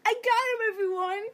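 high, loud triumphant cheering; excited gloating. Retold or imitated. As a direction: show off the prize, crying out. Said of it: "you wave 'em in the air and go".

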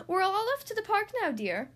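high, quick, bustling call; brisk announcement. A narrator's reading. Dad bursts in before the park, announcing. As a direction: announce the outing in a rush. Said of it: "he announced".

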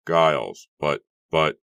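loud, halting astonishment; stammer, too astonished to think; breathless stammer. A narrator's reading. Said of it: "William stammered breathlessly".

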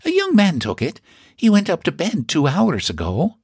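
put-on high Irish-accented landlady; a proud Irish housekeeper boasting. Mrs Purdy speaks.

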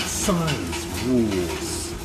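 low, very slow science rules; drawn-out low echo. Pretend.

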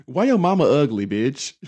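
loud sassy clapback; loud comeback. Retold or imitated. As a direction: fire back loud and sassy. Comeback to bullies.

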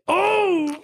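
sharp high gasping oh; jolted by the ammonia; hit with smelling salts. Real, not acted. Second sniff of smelling salts.

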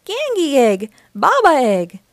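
put-on loud, high baby-talk call; excited toddler holler; toddler's shout. A narrator's reading. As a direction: shout it like a little one learning words. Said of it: "shouted George".

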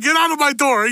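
high, loud mock yell while laughing. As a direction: shout like a cranky homeowner, hammed-up. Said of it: "he's like".

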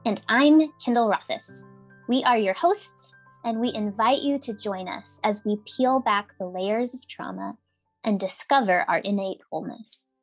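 ordinary talk

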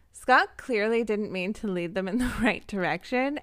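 usual speaking voice

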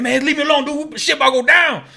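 high, loud mock tough voice; mock brush-off. Imitating himself answering the phone mid-fight, high mock voice. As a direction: wave off the caller.

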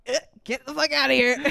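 lightly voiced gruff principal, quick. Principal yanking the mic from Taylor, gruff and quick. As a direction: brush her off fast and rough.

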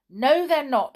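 firm, loud contradiction; loud protest. A narrator's reading. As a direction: call out over an excited crowd. Binky contradicts the shouting gnomes.

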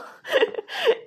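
high, loud triumphant cackle; gleeful laugh. Retold or imitated. Man laughing at Type in high loud triumph. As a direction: laugh like you just won. Man gloating after winning argument.

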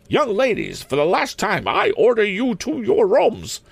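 high-pitched, imperious final order; stern schoolmistress. Acted. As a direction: command the pupils in a high headmistress voice. Principal's last order to pupils.

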